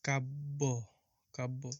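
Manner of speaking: কাব্য said slowly and clearly, the ব্য pronounced as a doubled ব; clear model pronunciation. Shown as a demonstration. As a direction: model কাব্য for the class, syllable by syllable. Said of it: "তাহলে শব্দটির উচ্চারণ কি হবে?".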